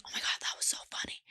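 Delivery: quick, gushing whisper; hurried whispered gossip; mock loud whisper. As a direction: imitate a chatty moviegoer whispering. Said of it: "don't whisper out loud".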